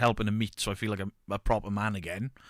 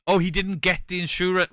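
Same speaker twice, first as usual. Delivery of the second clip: higher, louder mock surprise; mock realisation; 8 semitones higher, 6 dB louder than usual. Mocking the friend finally getting the joke, higher and louder. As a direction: act out the penny dropping.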